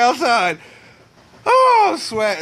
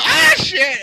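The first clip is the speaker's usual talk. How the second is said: high loud frightened cry; startled yelp; 13 semitones higher, 5 dB louder than usual. Real, not acted. Bird startles the man, high frightened yelp. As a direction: jump and curse in fright.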